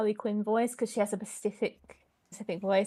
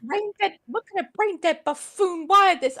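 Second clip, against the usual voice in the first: Joker rant; 7 semitones higher, 7 dB louder than usual. Acted. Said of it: "I must have been drunk".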